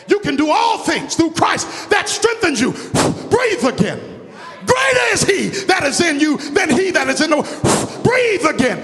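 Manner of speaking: high, loud, shouted preaching climax; hammering the refrain at the top of the voice; full-force preaching. Real, not acted. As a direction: roar it to the congregation, building.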